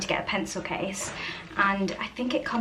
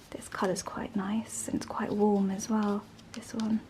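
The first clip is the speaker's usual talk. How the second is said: whispering; 7 dB quieter than usual. Real, not acted. Whispering while looking at colours.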